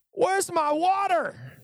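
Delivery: high nagging whine; whiny complaining Israelites. Retold or imitated. Israelites complaining to Moses about water, high whiny nag. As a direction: whine at Moses like tired kids. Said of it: "they start complaining to Moses".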